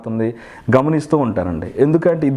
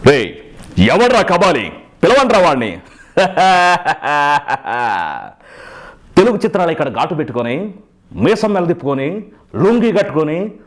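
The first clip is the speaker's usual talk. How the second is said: higher, imperious calls with a stylized laugh; Kabali film gangster; 3 semitones higher than usual. Acted. Mimic quoting Kabali on the sofa, swaggering gangster voice. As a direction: throw out the challenge like a film don. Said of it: "కబాలీలో sofa మీద కూర్చొని".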